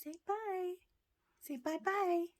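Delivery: high coaxing pet talk. Real, not acted.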